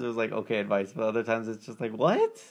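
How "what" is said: high, rising incredulous question; mock disbelief. Listener reacting to odd radio advice, mock incredulous. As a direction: pitch it right up in bafflement.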